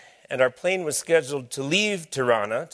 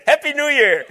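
laughing farewell; 13 semitones higher than usual. Retold or imitated. Dealer answering the speaker's greeting, laughing. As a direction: toss it back with a laugh.

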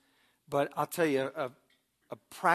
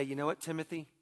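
gentle humble appeal; 6 dB quieter than usual. Retold or imitated. Father admits his own anger to his son, soft and humble. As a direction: speak softly, humbly asking your son for help. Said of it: "I was able to say".

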